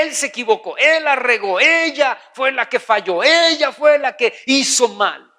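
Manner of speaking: blaming. Acted. Preacher mimicking someone who sees things humanly, blaming. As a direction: lean hard on who is at fault.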